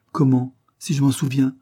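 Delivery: spirited, enthusiastic burst; lively enthusiasm. A narrator's reading. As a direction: answer with keen, youthful eagerness. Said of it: "avec une vivacité pleine d'enthousiasme".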